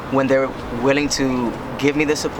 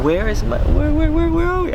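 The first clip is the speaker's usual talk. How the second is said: high squeaky mock confusion, building higher; mock lost panic; 3 dB louder than usual. Joking to the camera, pretending to be lost, rising high squeak. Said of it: "I'm just kidding".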